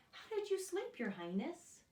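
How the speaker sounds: bright high queenly question. Acted. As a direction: ask sweetly like a grand queen. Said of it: "the queen swept into the bedroom and asked".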